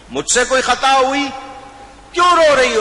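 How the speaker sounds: fast, high, loud worried questions; بیٹے کا بے چین سوال; anxious pleading. Acted. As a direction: press her urgently for an answer. Son anxiously asks mother.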